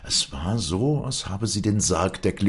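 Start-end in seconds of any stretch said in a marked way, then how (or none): none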